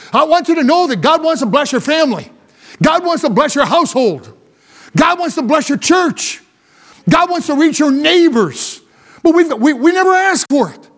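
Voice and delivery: impassioned pulpit delivery; forceful preaching. Real, not acted. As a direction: drive each phrase home to the congregation.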